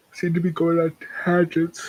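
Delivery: talking through a yawn; words stretched by a yawn. Real, not acted. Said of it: "Sorry for the yawn".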